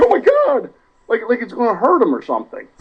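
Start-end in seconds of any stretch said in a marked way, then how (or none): 0.0-0.7 imitating a panicked player, much higher; a hammed-up frightened yelp as the ball flies at his face; scared kid flinching from a dodgeball, squealing; mock panicked squeal. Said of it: "throws his hands up in his face like".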